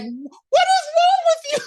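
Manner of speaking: very high, incredulous squeal; mock exasperation. Fan scolding Catherine over her giveaway, mock exasperation. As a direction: wail it in playful disbelief.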